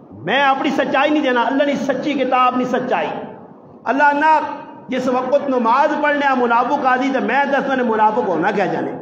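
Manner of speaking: ordinary talk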